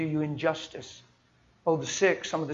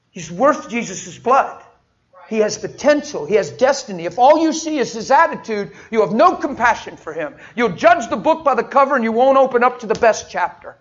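much louder, higher, driving the point home; preaching at full force; 7 semitones higher, 12 dB louder than usual. Acted. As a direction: thunder it to the congregation. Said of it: "So I'm preaching now".

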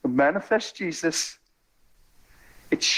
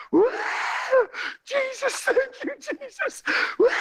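very high-pitched imitation of jubilant whooping; mimicked ecstatic church whoops; ecstatic praise. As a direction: squeal thanks like someone overwhelmed with joy. Acts woman rejoicing on the steps.

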